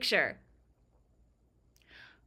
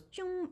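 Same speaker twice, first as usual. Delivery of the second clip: high, soft "choom" effect; imitated sword whoosh; 9 semitones higher, 10 dB quieter than usual. Streamer describing the sword on the loading text, imitated whoosh. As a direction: make a small sword swish noise. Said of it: "then the sword goes".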